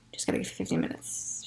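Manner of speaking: soft, unvoiced coaxing to herself; whispered self-talk. Telling herself to wait out the pain, whispered. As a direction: whisper it like a private pep talk.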